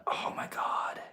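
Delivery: hushed, whispered awe; barely voiced, overwhelmed by romance; breathless swoon. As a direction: let it out as a soft, swooning whisper. Swooning over romance's adrenaline.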